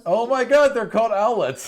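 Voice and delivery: high, loud delighted outburst; excited squeal. Real, not acted. Game master squealing over the owlet name, delighted.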